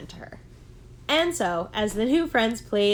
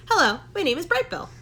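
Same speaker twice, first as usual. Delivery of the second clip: higher, bright gosling greeting; little gosling saying hello, higher and louder; eager little gosling; 8 semitones higher, 5 dB louder, faster than usual. Acted. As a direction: pipe up like a friendly little bird.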